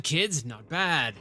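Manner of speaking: "kids, not bad" drawn out slowly and falling in a rustic accent; slow falling "not bad" in a country accent; slow sergeant accent. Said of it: "I slipped into that one".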